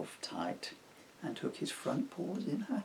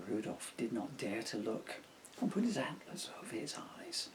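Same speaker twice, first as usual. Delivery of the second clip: usual speaking voice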